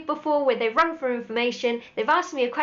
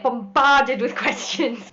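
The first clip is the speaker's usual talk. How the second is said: laughing; 5 dB louder, much slower than usual. Real, not acted. Laughing at being bombarded.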